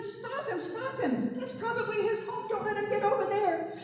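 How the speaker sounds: panicked yelling as he escapes; alarmed captor. A narrator's reading. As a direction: captor crying out in a high alarmed voice. Men shouting squirrel escaping.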